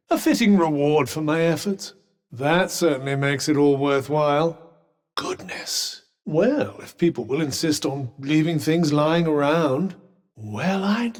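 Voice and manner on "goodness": whispered astonishment; soft, airy exclamation of wonder; hushed amazement. Acted. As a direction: let it out in an awed whisper. Amazed at a find.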